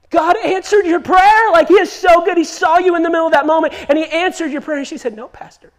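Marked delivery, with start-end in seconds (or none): 0.1-4.8 higher, louder, thrilled; amazed pastor. Retold or imitated. Amazed pastor voiced in story. 5.2-5.7 breathy hushed reply; soft whisper. Retold or imitated. Woman's quiet reply in story.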